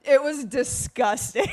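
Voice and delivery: laughing out the words; cracking up mid-sentence. Real, not acted. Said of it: "laughing while telling".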